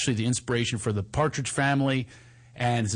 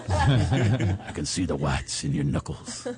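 calm coaching voice; 8 semitones lower than usual. Retold or imitated. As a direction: talk him down gently like a calm cowboy dad. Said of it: "I didn't do it with an accent".

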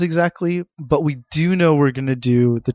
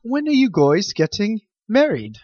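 bad British accent; 7 semitones higher than usual. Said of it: "Is that your British accent?".

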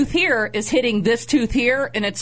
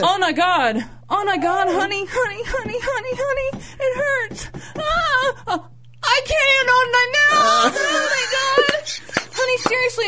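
high, whiny, near-crying pleading, much higher; panicked whining. Retold or imitated. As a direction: whimper to your wife as if your jaw is broken. Retold panicked whining to wife.

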